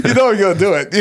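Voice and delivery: fast, laughing tease. Real, not acted. Host teases co-host, laughing. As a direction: laugh it at your partner.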